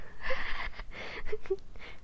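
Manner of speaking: high, breathless giggle; real fit of giggles; laughing. Real, not acted. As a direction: burst out giggling at your own stumble. Cracks up stumbling over a word.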